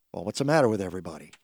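ordinary talk, nothing marked

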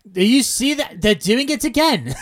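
hammed-up outraged caller. Host acting out an indignant character calling to Maud, hammed-up outrage. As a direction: play an outraged old man pointing something out.

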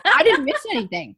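high, loud, laughing send-up of her defensiveness; mock indignant. Mocking her own defensiveness to the host, laughing and exaggerated. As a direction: ham up the offended denial through a laugh. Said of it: "This was my attitude".

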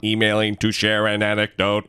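low, flat fifties robot voice. Pretend. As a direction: drone it like an old sci-fi robot. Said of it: "Cyborg R B G is a fifties robot".

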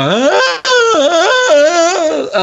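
long, high-pitched mock wail; imitated baby wail. Moviegoer mimicking babies crying in the theater, mock wailing. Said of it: "I'm hearing somebody just going".

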